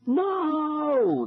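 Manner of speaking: high drawn-out wail; pained cry. A narrator's reading. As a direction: wail as the flames flare. Kids hurt by the fire.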